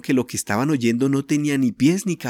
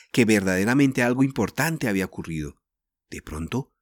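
plain reading voice

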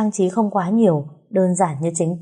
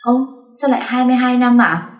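high, louder amazed question; a girl wide-eyed with surprise, pitch jumping up; astonished; 6 semitones higher, 4 dB louder than usual. Acted. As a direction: gasp it out in disbelief. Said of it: "trố mắt ngạc nhiên hỏi lại".

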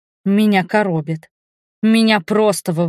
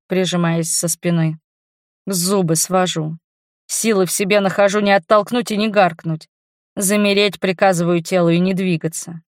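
ordinary delivery

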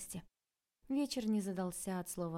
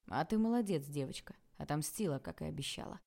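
usual speaking voice

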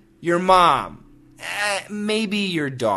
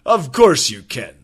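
laughing indulgent boss; 5 semitones lower than usual. Acted. Boss melting for woman.

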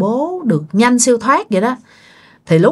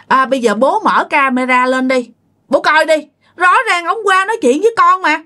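higher-pitched, insistent plea; insistent urging; 7 semitones higher than usual. Acted. A child urging the parents to check the camera, insistent. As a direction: urge the parents in a higher, pressing voice.

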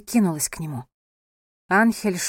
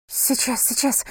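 high, flustered reply; 5 semitones higher than usual. A narrator's reading. Wife fusses over wounded husband, flustered. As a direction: fuss as you hurry to help. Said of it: "засуетилась София".

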